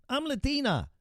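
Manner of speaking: high mocking voice; mock quote. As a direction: sneer it in a high put-on voice.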